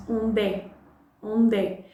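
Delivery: "onde" joined to é as onde, the d running into the e; linked onde é. Shown as a demonstration. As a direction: glue the d of "onde" onto é. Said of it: "the D from onde with the following E".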